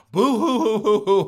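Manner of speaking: high, loud mocking boo-hoo sobs; fake crying. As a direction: wail boo hoo to ridicule them.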